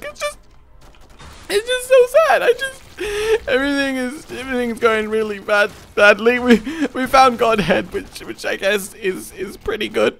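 high, whiny fake crying; mock sobbing. Player as his sad character, high whiny fake sobs. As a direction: whimper it out like a sad clown. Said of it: "he's like".

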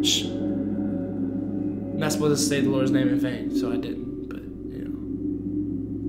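soft, slow guilty whisper; hushed whisper. Real, not acted. Fan hushing himself after saying God's name, whispering. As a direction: whisper it like you got caught.

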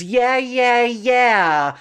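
lightly voiced wife, high and eager; a hint of the eager second wife; bright quick yeahs from his wife; Frangeline's voice. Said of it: "said my second wife, Frangeline".

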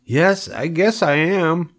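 high, drawn-out piglet voice; young pig. A narrator's reading. Wilbur the pig answers the old sheep, high piglet voice.

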